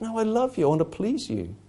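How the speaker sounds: softly, a husband reassuring his wife; tender reply. Retold or imitated. Husband softly telling his wife he loves her. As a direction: say it gently, with devotion.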